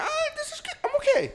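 very high, laughing mock voice; mock contentment. Recalling meeting Vinny, in a squeaky mock voice. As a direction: squeak it out, happily resigned. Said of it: "I was like".

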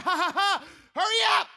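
loud, high forced laugh into a shout; irritated fake laugh. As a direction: fake the laugh, then yell impatiently. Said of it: "I'm getting irritated".

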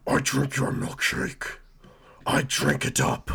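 slow gruff mock Batman voice; bad Batman growl, drawn out; Daniel Day-Lewis as Batman. Podcast host hams up Batman to the Riddler, slow mock growl. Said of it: "that was my best D D L impression as Batman".

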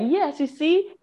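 high, loud self-cheering; hype man. Retold or imitated. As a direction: pump yourself up like a hype man. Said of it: "I'm my hype man".

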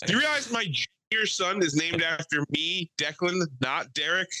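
comic outraged retort; mock indignation. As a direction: play at being fed up with the neighbour. Acted indignant retort to neighbour.

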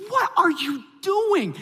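very high, slow, drawn-out disbelief; incredulous self-scolding. Retold or imitated. Dad to himself after promising prayer works, incredulous self-scolding. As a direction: stretch it out, aghast at yourself.

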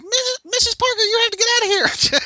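really high-pitched squeaky voice; comic falsetto, urgent and hurried; Peter Parker. Retold or imitated. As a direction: squeak it out as if hit in the groin. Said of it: "It's really high-pitched Peter Parker".

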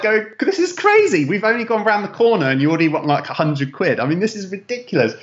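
high-pitched, fast, incredulous protest at the driver; exasperated outburst. Retold or imitated. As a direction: squeal it out in disbelief at the meter. Exasperated at a rip-off taxi meter.

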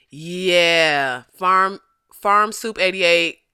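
normal talking voice

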